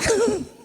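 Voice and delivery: short high laugh, amused at herself; burst of laughter. Real, not acted. Panellist laughing at why she is here, high bright laugh.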